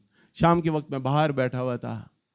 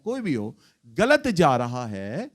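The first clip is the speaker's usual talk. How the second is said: ordinary talk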